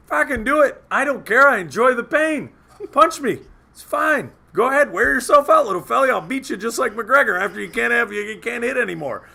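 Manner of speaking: high, loud mock taunt; retold goading. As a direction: imitate a friend daring him on. Imitating Travis goading the buddy.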